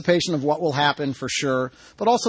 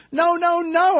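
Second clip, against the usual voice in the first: high, pleading shout; protesting shout; 13 semitones higher, 5 dB louder, slower than usual. A narrator's reading. Kicker to his coach, high protesting shout. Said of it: "I shout to Shanahan".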